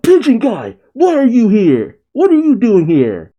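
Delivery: high-pitched mock yell; hammed-up shouted questions; Luffy yelling. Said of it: "Luffy yells out".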